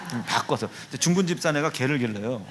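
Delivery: usual speaking voice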